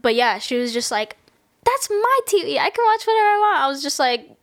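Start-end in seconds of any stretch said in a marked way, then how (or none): 1.6-3.6 high-pitched imitation of a protesting child; bratty kid. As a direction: mimic a spoiled kid claiming what's hers. Acting the kid protesting to mom.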